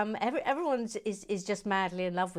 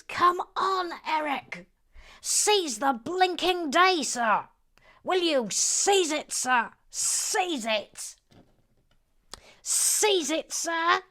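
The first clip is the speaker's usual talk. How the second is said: loud, high, drawn-out rallying cry; hammed-up urging, punching each word; rousing exhortation; 8 semitones higher, 5 dB louder, much slower than usual. Host urges Eric to seize the day, rousing exhortation.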